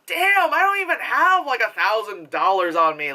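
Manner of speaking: high-pitched incredulous outburst; mock amazement. Moviegoer reacting to a stack of cash, mock amazed. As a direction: start way up high, then settle down.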